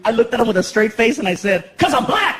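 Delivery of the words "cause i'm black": shouted punchline; mock shout. Shouting it at the cop.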